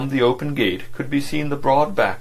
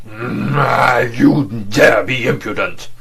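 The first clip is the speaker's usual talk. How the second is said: louder, slow, guttural un-English outrage; slow, loud, guttural foreign fury; guttural foreign outburst; 2 semitones higher, 8 dB louder, much slower than usual. Acted. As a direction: erupt in a strange guttural accent. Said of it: "with an amazingly guttural intonation".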